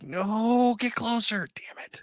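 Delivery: high-pitched pleading, fading to a mutter; imagined frustration. Retold or imitated. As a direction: plead high, then give up under your breath.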